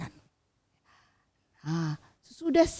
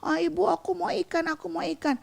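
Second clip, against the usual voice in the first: put-on higher whining kid begging; whining child; 6 semitones higher, faster than usual. A narrator's reading. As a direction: whine for fish like a child about to cry. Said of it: "mulai merengek nangis". (merengek seperti anak kecil)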